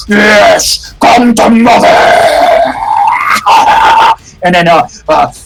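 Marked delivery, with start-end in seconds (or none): 0.1-1.9 screamed monster voice; monstrous shouted summons; screaming creature. Acted. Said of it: "you can see the thing screaming". 1.9-4.1 inhuman shrieking cackle; creature scream. Acted. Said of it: "you can see the thing screaming".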